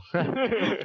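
high sharp laugh; burst of laughter. Real, not acted. Gamer laughing at friend's joke.

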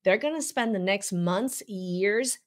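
normal talking voice

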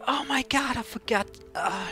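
ordinary talk, nothing marked

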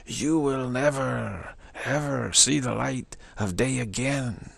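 slow, drawn-out mock proclamation; stern decree. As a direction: spell it out slowly like a lightly voiced judgment. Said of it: "It says, says, says".

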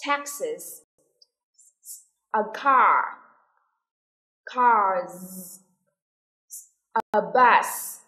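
isolated model words, each followed by a long pause; repeat-after-me drill. Shown as a demonstration. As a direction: model each singular and plural clearly for a class. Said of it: "listen and repeat after me".

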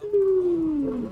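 cheering "woo", high and gliding down; drawn-out falling whoop; long high woo. Real, not acted. Caster cheering the thirty viewers, long high woo.

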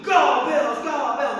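high-pitched crowd chant; riled-up fans. Acted. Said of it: "these guys are all riled".

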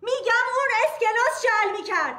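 shouting. Acted. Lawyer shouting at the quarrelling wife to stop. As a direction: cut through the quarrel with a shout.

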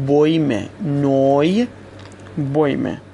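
بویمه and نوی with an "oy" glide; oy diphthong. Shown as a demonstration. Teacher repeats example words, بویمه and نوی with clear "oy". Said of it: "هر دو به صورت اوی تلفظ".

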